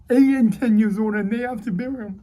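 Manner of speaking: high, emotional outburst starting loud; tearful. Real, not acted. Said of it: "I apologize for crying".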